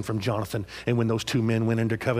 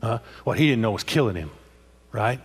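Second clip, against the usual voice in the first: normal talking voice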